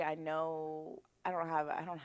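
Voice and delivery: ordinary delivery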